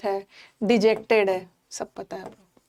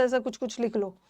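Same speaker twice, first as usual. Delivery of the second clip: normal talking voice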